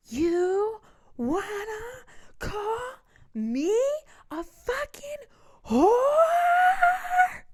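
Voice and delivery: high, slow, screaming rage; furious shrieking yell. Retold or imitated. Yelling at the man in the car, shrieking in rage. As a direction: shriek it out like she's losing it.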